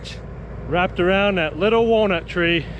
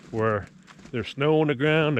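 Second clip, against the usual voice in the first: ordinary talk, nothing marked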